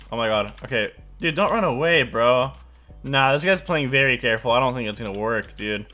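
ordinary talk

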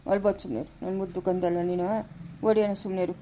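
soft, low hush for a weeping little one; quiet lullaby-like comfort; soothing a crying child. Acted. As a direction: coo gently to calm the baby.

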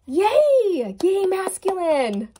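high, loud delighted yay; excited cheer. Real, not acted. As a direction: cheer them on with joy. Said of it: "I'm getting pumped".

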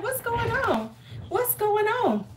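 high-pitched mock confusion; high, puzzled self-quote; retold bewilderment. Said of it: "I was like".

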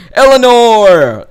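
high, loud, drawn-out call; long mock holler. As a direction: holler the name, falling at the end. Calls out to game character.

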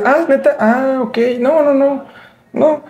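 very high incredulous "¿neta?"; mock surprise. Friend hearing about the Reyes call, very high disbelief. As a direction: squeal it in disbelief.

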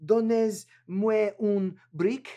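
slow, hammed-up French accent; mock French. Builder asking for a brick in French, mock French accent. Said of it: "Or in French".

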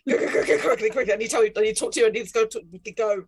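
loud, rushed and laughing panic; giggly frantic go go go; frantic urging. Acted. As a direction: hurry her out the door in a flap. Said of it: "grab Kitty's like".

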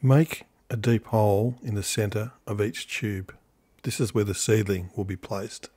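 low, soft and measured documentary read; quiet recorded how-to voiceover; voiceover narration. Acted. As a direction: calmly narrate the steps for the viewer.

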